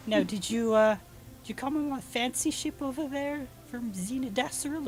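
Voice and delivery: high, loud halfling voice; inquisitive halfling woman. Acted. Halfling asks travelers about their ship, high loud voice. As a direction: question them in the high halfling voice.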